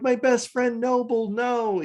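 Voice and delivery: mock anguish. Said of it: "Night Scream is like".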